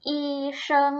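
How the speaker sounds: อี เชิง as two separate, even syllables; model pronunciation. Shown as a demonstration. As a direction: sound out อี, then เชิง, carefully for learners. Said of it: "ออกเสียงตามเหล่าชื่อนะคะ".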